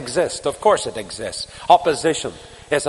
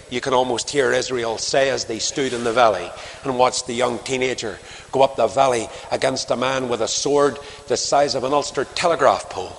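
normal talking voice

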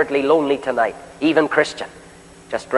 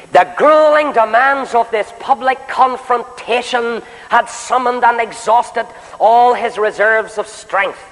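raised, slow, ringing pulpit voice; fervent preaching; 8 semitones higher, slower than usual. Acted. Preacher rousing the meeting about Elijah, fervent and raised. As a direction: lift the hall with rising preacher cadence.

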